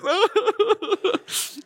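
high, long burst of laughter; laughing hard. Real, not acted. Preacher laughing at his own embarrassing burger story, high and long. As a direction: crack up at the memory.

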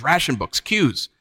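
usual speaking voice

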